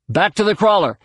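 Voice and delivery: high, urgent shouted order; shouting. A narrator's reading. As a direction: shout them back to safety. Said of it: "Jason shouted".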